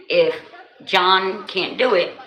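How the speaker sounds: ordinary delivery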